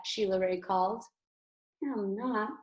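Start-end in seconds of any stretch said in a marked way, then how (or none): 1.8-2.5 soft little whispered reply. A narrator's reading. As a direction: barely breathe the words. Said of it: "whispered Louise".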